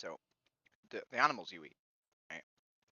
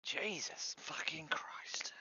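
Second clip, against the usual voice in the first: slow whispered curse; 8 semitones higher, much slower than usual. Real, not acted. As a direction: hiss the curse out slowly, unvoiced. Whispers reaction to spicy food.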